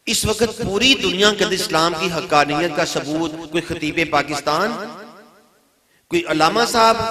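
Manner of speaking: loud, high preaching questions; rhetorical challenge. Real, not acted. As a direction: throw each suggestion out to the crowd. Preaches at raised force.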